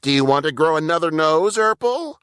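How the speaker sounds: high, fast scolding; high, fast chiding Burple; chiding little brother. A narrator's reading. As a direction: nag your big brother like your mother would. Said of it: "Burple chided".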